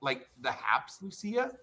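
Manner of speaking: ordinary talk, nothing marked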